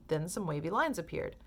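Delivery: ordinary delivery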